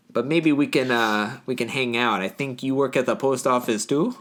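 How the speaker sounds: mock Upper Midwest accent; North Dakota accent. Local courting a woman from the diner, jokey North Dakota accent. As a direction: play an apologetic small-town Minnesotan suitor in accent. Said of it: "gonna talk like this in the accent".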